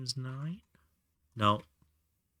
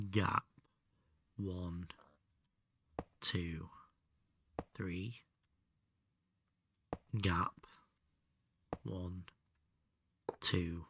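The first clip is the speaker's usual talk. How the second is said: whispered counting; 3 semitones lower, 9 dB quieter, much slower than usual. Real, not acted. Counting under breath.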